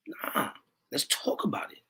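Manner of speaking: breathy whisper, softly brushing it off. Real, not acted. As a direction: hush it down to a whisper. Dismissive aside after shout.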